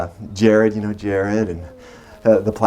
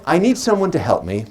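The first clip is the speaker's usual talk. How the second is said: normal talking voice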